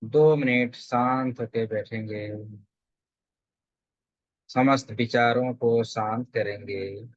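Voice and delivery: calm, measured meditation guide; settled, evenly paced guidance with long pauses; guided meditation. Real, not acted. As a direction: lead them gently into stillness, phrase by phrase.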